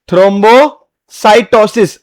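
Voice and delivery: thrombocytosis drawn out very slowly, syllable by syllable; slow sounding out. Shown as a demonstration. Teacher to students, sounding out thrombocytosis very slowly. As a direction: lay out thrombocytosis one syllable at a time. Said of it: "teacher sounds out the new term".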